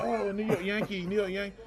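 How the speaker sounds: lightly mocked fan excitement; mimicked excited onlookers. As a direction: imitate strangers spotting a ballplayer. Mimicking onlookers recognising a Yankee.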